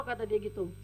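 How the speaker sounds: normal talking voice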